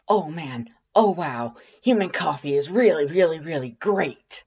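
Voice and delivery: caffeine-buzzed gush; excited. A narrator's reading. Undyne buzzing on her first coffee, gushing excitedly.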